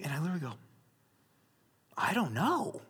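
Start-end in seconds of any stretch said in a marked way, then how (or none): 2.0-2.9 soft answer breaking into high laughter; helpless mock confession. Boyfriend admitting he has no idea, laughing helplessly. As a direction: say it small, then crack up at yourself.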